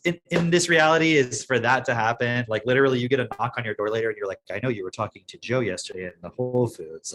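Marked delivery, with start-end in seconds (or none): 4.5-7.1 ominous official. Pretend. Imagined police at door.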